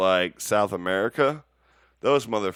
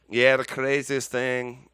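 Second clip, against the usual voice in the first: put-on shaky stab at a British accent, slow and raised; attempted British accent; 4 semitones higher, 5 dB louder, slower than usual. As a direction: try a British accent and miss. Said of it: "I can't do a British".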